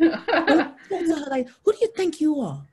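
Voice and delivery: high, loud mock Scottish scolding; indignant Scottish accent, laughing through it; hammed-up Scottish telling-off; Caroline. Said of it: "Caroline was from Scotland".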